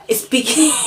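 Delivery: a burst of high laughter after a slip of the tongue; laughing at herself. Real, not acted. Advising Sana, she stumbles on a word and laughs out loud. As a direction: break into real laughter at her own fumble.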